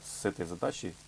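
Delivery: ordinary talk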